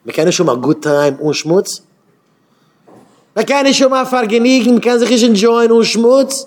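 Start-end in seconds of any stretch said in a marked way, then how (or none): 3.3-6.5 high, loud incredulous challenge; exasperated outcry. Real, not acted. Speaker challenges listeners on fun without filth, exasperated shout. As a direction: throw the question out at full cry.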